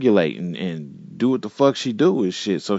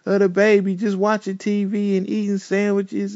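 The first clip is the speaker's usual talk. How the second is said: higher, mock-earnest protesting voice; pleading innocence; 9 semitones higher than usual. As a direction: play the girlfriend pleading her case. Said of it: "trying to say".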